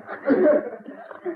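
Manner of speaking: a burst of high-pitched laughter. Real, not acted. Old man laughing at his own story.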